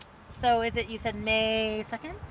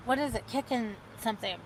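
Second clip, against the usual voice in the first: usual speaking voice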